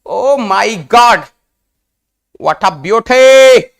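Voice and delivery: loud, slow, awestruck delight; loud admiring gasp of joy; delighted exclaiming. Real, not acted. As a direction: marvel out loud at something beautiful. Teacher marvels at a diagram.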